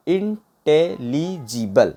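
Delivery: Intelligible very slow, each syllable set apart; broken into syllables. Shown as a demonstration. Teacher showing how to break words, intelligible syllable by syllable. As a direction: say intelligible one syllable at a time. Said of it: "break करके भी पढ़ सकते हैं".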